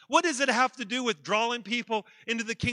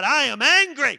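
higher, much louder shout of anger; angry outburst; 5 semitones higher, 11 dB louder than usual. Acted. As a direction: shout it at God, furious. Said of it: "he even told God".

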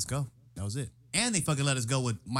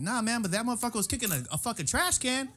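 pleading explanation; 10 semitones higher than usual. Retold or imitated. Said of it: "I was like".

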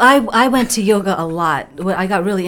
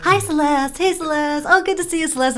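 bright higher sing-song hellos; mock cheery greetings; 9 semitones higher than usual. Classmates cheerfully greeting her by the wrong name. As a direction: imitate chirpy classmates.

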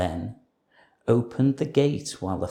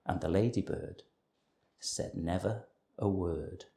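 plain reading voice

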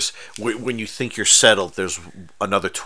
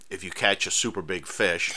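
usual speaking voice

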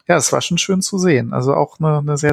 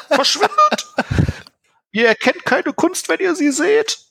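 much higher, furious artist's rant; Kinski-style outburst. Retold or imitated. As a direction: explode like an enraged, offended artist. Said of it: "so ein Kinski-mäßiger Ausraster".

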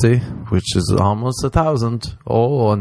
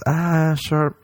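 high lilting Irish; Irish pub voice; 7 semitones higher than usual. Retold or imitated. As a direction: coax like an Irish drinker. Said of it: "one becomes".

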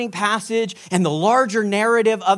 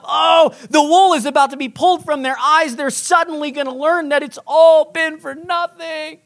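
high, wailing dismay; hammed-up alarm, pitched up throughout; mock panic; 6 semitones higher than usual. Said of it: "I had a mini panic attack".

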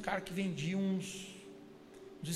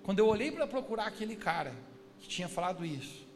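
usual speaking voice

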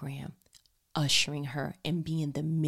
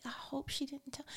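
soft, much quieter whisper; hushed worry; 9 semitones higher, 10 dB quieter than usual. Retold or imitated. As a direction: whisper it to yourself, embarrassed. Embarrassed thought about daughter.

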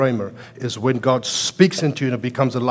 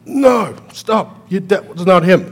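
sharp, high, louder "no, stop"; firm stop; 6 semitones higher, 6 dB louder than usual. Retold or imitated. Preacher cutting off a false claim, high louder protest. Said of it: "that I'm just like".

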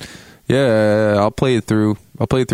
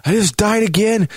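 high exasperated curse, slow whispered swearing; high whiny frustration at the game; frustrated gamer; slower than usual. Retold or imitated. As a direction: fume after dying again. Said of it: "And I'm like".

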